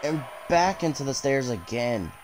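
lively ringside play-by-play; wrestling commentator. Acted. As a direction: call every move as it lands. Calls the match live.

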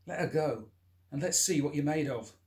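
a man daring Blondie to fight; loud challenge. Acted. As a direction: square up and dare him. Challenging the attacker.

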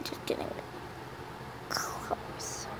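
whisper. Real, not acted. Unvoiced whisper.

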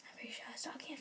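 fast conspiratorial whisper. Real, not acted. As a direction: whisper it so she can't hear. Secret guess whispered aside.

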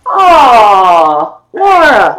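high, loud gushing aww; touched aww. Real, not acted. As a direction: melt with affection, drawing out the aww. Moved by a viewer's kind gift.

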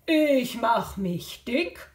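slow, stretched-out fattened voice; puffed-up voice. Acted. Jaguar making himself fat in the game, slow puffed-up voice. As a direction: puff yourself up on DICK.

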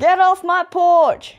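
put-on grumpy old man yelling; shouting at trespasser. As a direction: bellow it like a cranky old man.

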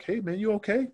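high, easygoing imitation voice; mellow impression. Mellowed old colleague greeting a friend, high mellow impression. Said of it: "he's just mellow".

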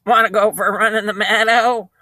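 high, quick, quavering sheep voice; talking sheep, fast and wobbly; bleating sheep. Acted. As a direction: bleat the whole question like a sheep. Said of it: "said the sheep".

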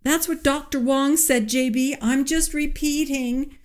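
high, meek assistant voice; nervous assistant. A narrator's reading. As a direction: defend yourself timidly.

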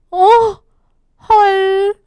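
high, loud gasp of shock; shocked zookeeper. Acted. Teacher acting out the zookeeper's face, stunned.

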